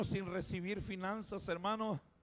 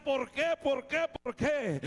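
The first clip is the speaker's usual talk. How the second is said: anguished why; 7 semitones higher, 6 dB louder, slower than usual. Retold or imitated. Crying out to God.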